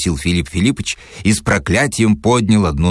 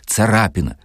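ordinary delivery, nothing marked

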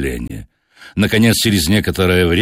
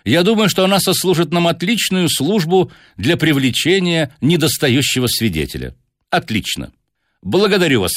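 higher-pitched, courteous detective; Holmes giving orders; 8 semitones higher than usual. Acted. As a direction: voice the detective politely directing, pitched up. Narrator voices Holmes.

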